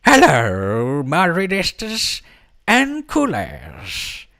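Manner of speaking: high, slow, loud put-on leprechaun voice; awful leprechaun imitation. Leprechaun greeting fans at the rainbow, badly faked squeaky voice. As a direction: ham up a bad little troll greeting. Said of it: "That is one awful, awful little troll, uh, imitation".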